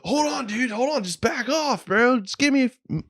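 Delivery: high-pitched mock pleading; flustered buddy. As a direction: imitate a caught friend stalling for time. Voices buddy stalling, caught in act.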